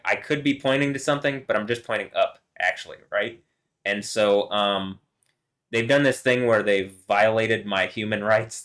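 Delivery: normal talking voice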